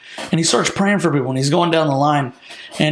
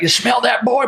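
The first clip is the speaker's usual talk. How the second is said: raised country-prophet voice; put-on country questioning, higher pitch; Bob Jones; 7 semitones higher than usual. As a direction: mimic a folksy preacher pressing his question. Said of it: "He's, like, a country prophet".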